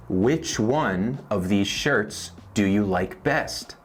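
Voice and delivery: slow, word by word; slow teaching read. Shown as a demonstration. Teacher to Spanish learners, slow model question. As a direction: spell the question out slowly. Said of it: "Por ejemplo".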